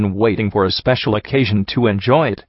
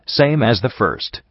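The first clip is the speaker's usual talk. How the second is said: usual speaking voice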